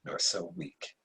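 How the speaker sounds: put-on frail, low old emperor; a hint of a weak dying ruler. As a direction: speak as a dying father to his son. Dying emperor speaks to son.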